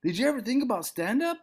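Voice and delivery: a hint of an eager friend; friend asking. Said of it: "He's like".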